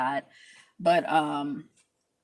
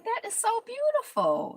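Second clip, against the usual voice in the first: much higher, delighted squeal; high gushing. Retold or imitated. Watching the curls set free, squealing high. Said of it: "just like".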